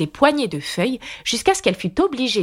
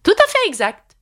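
high, loud triumphant cry; exclaimed; 5 dB louder than usual. A narrator's reading. Red Queen triumphing over Alice, in a high loud cry. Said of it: "s'écria la Reine Rouge".

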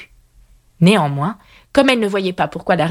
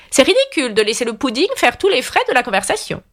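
higher-pitched imperious reproach; scolding queen; 6 semitones higher than usual. A narrator's reading. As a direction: chide her as an impatient queen. Red Queen scolds Alice. (reine qui gronde, voix plus aiguë)

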